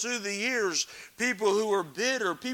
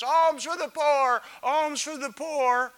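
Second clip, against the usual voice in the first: higher, louder pleading beggar's call; beggar's plea; 7 semitones higher, 6 dB louder than usual. Acted. Lame beggar to passersby, pleading call. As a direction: beg for coins, drawn out. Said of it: "he's a beggar holding out a jar".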